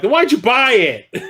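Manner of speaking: high, slow mock disbelief; mock exasperation. Questioning mom about an unwatched movie, mock incredulous. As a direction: stretch it out, incredulous.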